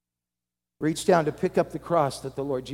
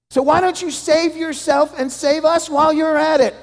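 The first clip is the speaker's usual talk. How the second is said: loud, high jeering taunt; mocking shout; 13 semitones higher, 11 dB louder than usual. Criminal beside Jesus jeering at him, loud taunt. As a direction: throw the challenge at him in scorn. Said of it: "began to shout and make fun of him".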